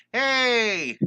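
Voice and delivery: high, loud cheerful call; excited hey. Real, not acted. Art teacher hailing a new viewer, high and loud. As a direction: shout a happy hey to a newcomer.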